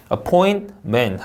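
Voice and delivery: "appointment" as a-POIN-men; no vowel between T and M in "appointment"; no inserted vowel. Shown as a demonstration. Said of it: "T랑 M 사이에 모음 없잖아요". ("appointment"의 T와 M 사이 모음 없이)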